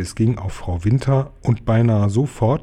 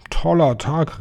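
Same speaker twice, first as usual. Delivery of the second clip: higher-pitched, overjoyed exclamation; elated, raised-pitch hunter's cry; delighted cry; 6 semitones higher, slower than usual. A narrator's reading. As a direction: call it out, beside yourself with joy. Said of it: "rief Herr Hai".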